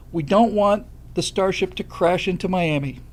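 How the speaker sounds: usual speaking voice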